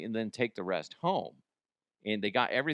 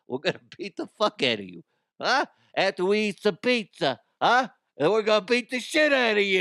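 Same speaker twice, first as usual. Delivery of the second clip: high, loud hammed-up New York threats; mock New York accent; 10 semitones higher, 7 dB louder than usual. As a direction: play a threatening New Yorker as a cartoon. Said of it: "perfect New York accent".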